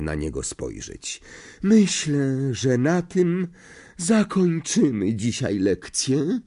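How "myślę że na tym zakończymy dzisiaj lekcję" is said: high, slow, dreamy seer voice; misty voice. A narrator's reading. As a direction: end the lesson as if in a mist. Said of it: "swoim cichym, jakby mglistym głosem".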